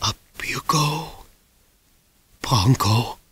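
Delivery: soft breathy whisper; dying whisper. A narrator's reading. Dying doctor whispering to the boy as he lifts him to safety. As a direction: whisper with his last strength. Dying man's last words.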